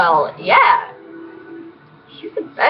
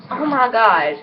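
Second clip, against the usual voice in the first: normal talking voice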